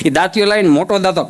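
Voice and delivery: ordinary delivery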